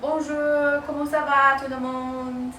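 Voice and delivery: a hint of French pronunciation. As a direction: greet them lightly in French. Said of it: "I speak French".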